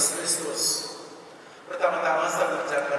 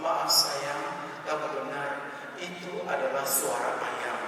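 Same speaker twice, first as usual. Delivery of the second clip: soft, lowered conciliatory voice; gentle apology; 3 semitones lower, 7 dB quieter, slower than usual. Retold or imitated. Husband gives in to his tearful wife, gentle. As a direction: give in tenderly and quietly. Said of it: "Akhirnya dengan lembut dia berkata".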